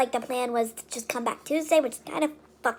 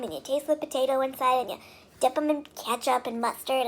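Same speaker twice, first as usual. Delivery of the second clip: ordinary talk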